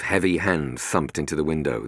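ordinary talk, nothing marked

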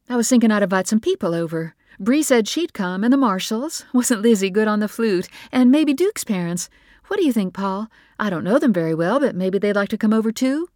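smooth things over with bright chatter; nervous excited mother. Acted. Mother rushing to cover the tension, chattering. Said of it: "Everyone is just a little excited".

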